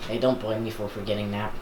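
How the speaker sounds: ordinary talk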